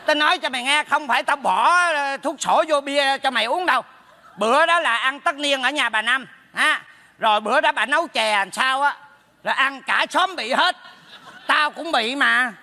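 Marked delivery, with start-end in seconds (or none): none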